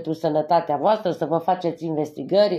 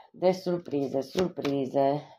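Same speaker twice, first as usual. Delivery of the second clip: softer, lower murmur over the cards; murmured to herself; 5 semitones lower, 7 dB quieter, much slower than usual. Real, not acted. Tarot reader mutters over the surprise cards, softly. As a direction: mumble the word to yourself while thinking.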